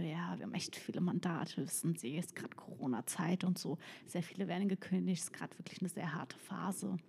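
telling the officer quietly about her law firm; hushed. Retold or imitated. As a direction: answer the officer in a hushed, serious voice.

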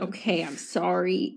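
slowed-down calm apology. Shown as a demonstration. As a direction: ease off and say it slowly. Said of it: "Slow down".

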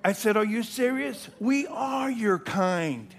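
loud, high, fervent preacher's cry; thundering sermon delivery; full-force preaching. Acted. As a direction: preach it out with fire. Preaching climax shouted.

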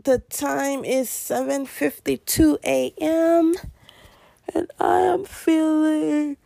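slow, drawn-out morning announcement; sleepy drawl that drags out to the end; tired. Real, not acted. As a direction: stretch each phrase lazily, pitched a little high. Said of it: "I'm so tired".

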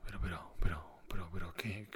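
whispered, stammering disbelief; stunned whisper. Pretend. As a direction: breathe it out, lost for words. Stunned at price rise. (susurro incrédulo)

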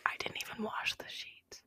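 hushed, guilty admission; soft, voiceless secret-telling; whispered confession. Real, not acted. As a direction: admit it in a whisper.

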